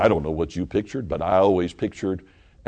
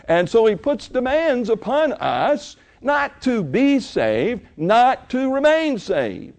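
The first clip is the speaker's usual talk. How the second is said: rising, louder pulpit preaching; 10 semitones higher, 5 dB louder than usual. Acted. Pastor preaching to his church, loud and rising. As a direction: drive it home to the congregation.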